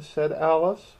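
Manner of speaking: usual speaking voice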